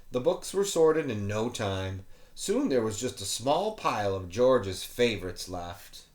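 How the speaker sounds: ordinary delivery, nothing marked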